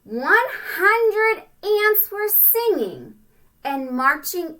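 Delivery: sing-song storybook reading; rhyming read-aloud. A narrator's reading. Parent reading a rhyming picture book to a child, sing-song. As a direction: bounce through the rhyme for kids.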